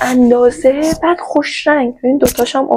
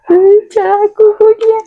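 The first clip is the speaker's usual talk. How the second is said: much higher, louder cutesy squeal; 11 semitones higher, 5 dB louder than usual. Real, not acted. Showing the viewers the little shaver, a high cutesy squeal. Gushing over a tiny shaver.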